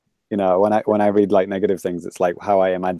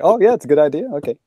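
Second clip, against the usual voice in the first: mock agreement; 7 semitones higher, faster than usual. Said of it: "And they're like".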